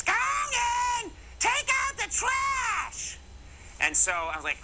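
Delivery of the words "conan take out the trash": very high, drawn-out mock-mom holler; mom yelling downstairs. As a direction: holler it like a mother calling down the stairs. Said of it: "My mom yells down the stairs".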